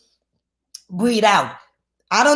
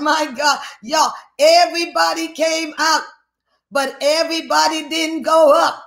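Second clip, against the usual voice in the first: raised, ringing sermon voice; fervent scripture preaching; 4 semitones higher than usual. Real, not acted. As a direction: declaim the verse to the congregation. Preaches at full force.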